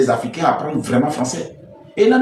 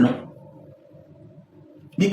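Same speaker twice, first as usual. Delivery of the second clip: ordinary talk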